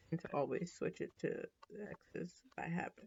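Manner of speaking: soft voiceless aside to self; whispered aside. Real, not acted. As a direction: whisper it under the breath. Muttered aside about own habit.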